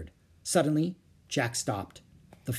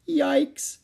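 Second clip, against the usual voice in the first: high, loud little exclamation; alarmed yelp; 13 semitones higher, 5 dB louder than usual. Acted. Annie as the rainforest gets darker, alarmed.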